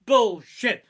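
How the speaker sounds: loud, high bark of Bullshit; angry shout. Acted. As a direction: spit it out in full fury. Comic outburst at dog owners.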